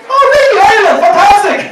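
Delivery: high, loud gushing delight; overjoyed at meeting someone Irish; delighted doctor. Retold or imitated. Doctor thrilled to hear patient is Irish, high and loud. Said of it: "doctor excited about Ireland".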